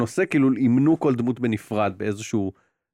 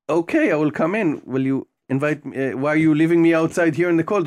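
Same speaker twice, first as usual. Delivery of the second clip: slow, carefully spelled-out English, a bit higher; talking to the game character; 5 semitones higher, 3 dB louder, much slower than usual. Retold or imitated. Player coaxing the character to let him in, slow and clear. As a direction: speak slowly and clearly so the AI understands. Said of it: "אתה צריך להגיד לו".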